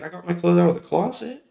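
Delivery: lightly voiced innocent child; a hint of a proud little kid; naive kid explaining. His naive younger self explaining.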